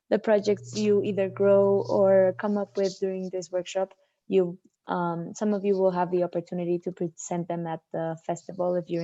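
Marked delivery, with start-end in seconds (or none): none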